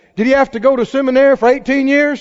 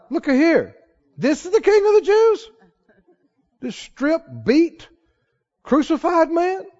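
scornful jeer, pitched up; high mock disbelief; mockery; 6 semitones higher than usual. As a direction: sneer at the crucified man. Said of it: "It was a mock".

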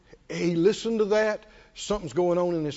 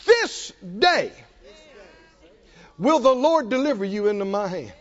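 louder, steady proclamation; bold declaration; 2 semitones higher, 3 dB louder than usual. Retold or imitated. David telling the Philistine his fate, bold. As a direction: declare victory with faith. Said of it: "Keep going".

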